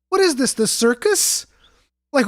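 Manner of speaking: ordinary talk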